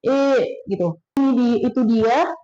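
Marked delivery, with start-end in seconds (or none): 0.1-0.2 E said as an open, drawn "eh", for German ä; A umlaut as E. Shown as a demonstration. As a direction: pronounce ä simply as E. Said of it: "A umlaut itu memang benar-benar kita ngomongnya E". (A umlaut dibaca E)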